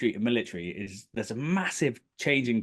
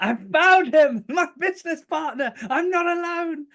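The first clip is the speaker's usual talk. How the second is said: much higher, laughing, giddy with joy; giddy excitement; 6 dB louder than usual. Retold or imitated. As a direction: gush like you found a long-lost friend. Retelling his own naive joy.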